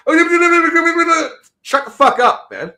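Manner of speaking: high, loud snap; snapping at noise. Retold or imitated. Annoyed at loud noise on the bus, loud snap. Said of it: "If I'm on the bus and I hear".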